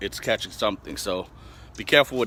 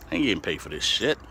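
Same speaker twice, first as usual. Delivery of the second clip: ordinary talk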